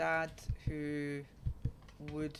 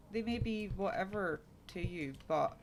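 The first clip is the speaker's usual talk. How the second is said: usual speaking voice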